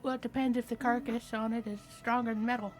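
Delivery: normal talking voice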